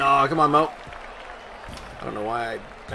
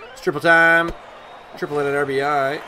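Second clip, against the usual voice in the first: ordinary talk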